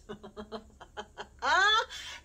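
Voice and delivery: loud, high laugh; real laugh. Real, not acted. Mom laughing at the idea of a planner video. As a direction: burst out laughing.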